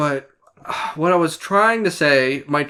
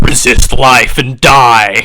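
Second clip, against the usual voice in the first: low, loud, drawn-out parody; slow, booming mockery of a friend's words; mock imitation; 4 semitones lower, 11 dB louder, much slower than usual. Teasing a friend about his motto, mocking. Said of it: "you were, like".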